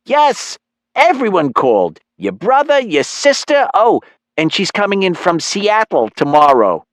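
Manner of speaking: high, eager mom with family news; excited mother. Acted. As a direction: reel off the news as a delighted, high-voiced mom. Narrator voices the excited mother.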